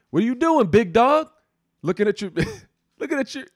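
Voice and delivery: high, loud Kentucky twang; poorly imitated Kentucky accent, laughing; thick Kentucky accent. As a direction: holler it in a mock Kentucky accent. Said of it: "I can't even do her accent".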